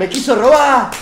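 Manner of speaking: high, loud joking cry; hammed-up shouted complaint; mock accusation. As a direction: pretend to accuse him of theft.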